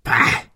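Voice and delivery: contemptuous "bah"; spat-out scoff. A narrator's reading. Villain dismissing greedy hypocrites, spitting scoff. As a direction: spit out a short noise of disgust. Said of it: "Er spuckte aus".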